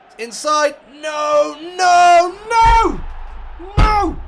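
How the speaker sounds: rising, howling no after no; despairing shout. Real, not acted. Gamer howling at the screen as Newcastle score, anguished shout. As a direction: yell in anguish as the goal goes in. Goal conceded in his game.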